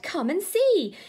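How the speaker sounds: put-on high, loud, thrilled child's voice; excited little boy. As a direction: gush out the news like a delighted little boy. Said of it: "he cried".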